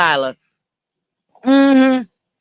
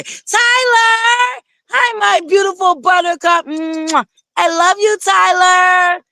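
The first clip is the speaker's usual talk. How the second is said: excited shout-out; 7 semitones higher, slower than usual. Real, not acted. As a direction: call her name across the room with joy. Calling out to a friend.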